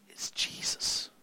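slow, whispered declaration; hushed reverence. Real, not acted. Preacher says who deserves the credit, in a reverent whisper. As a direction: drop right down to a slow whisper.

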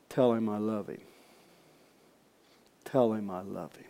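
low, soft, hushed message; gentle divine voice. Retold or imitated. The Lord tells the preacher to tell him, gentle and low. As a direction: say it tenderly, leaving space after it. Voicing the Lord's quiet answer.

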